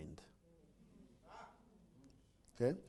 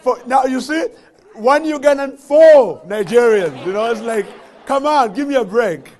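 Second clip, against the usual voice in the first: high, loud, laughing protest; loud playful banter; 7 semitones higher, 14 dB louder than usual. Retold or imitated. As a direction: tease him at the top of your voice.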